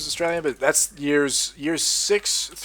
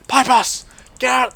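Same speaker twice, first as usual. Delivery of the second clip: much higher, louder callout; shout; 11 semitones higher, 7 dB louder, slower than usual. Real, not acted. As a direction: yell the warning at the enemy. Calling out an enemy in game.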